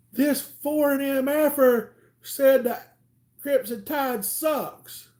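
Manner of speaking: high, slow indignant complaint; outraged buddy. Retold or imitated. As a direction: tattle on him, outraged. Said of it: "he's like".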